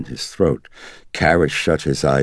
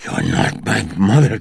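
vehement whisper; 7 semitones higher, 5 dB louder than usual. A narrator's reading. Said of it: "vehemently whispered".

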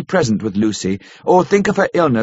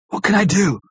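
higher-pitched, mildly hoarse question; 7 semitones higher than usual. A narrator's reading. Suitor asks how to help, a touch hoarse. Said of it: "asked Arthur hoarsely".

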